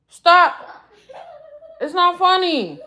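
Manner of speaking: high, loud protest through laughter; laughing as you tell them off; half-laughing, high-pitched scolding; amused protest. Real, not acted. Protesting while amused at someone.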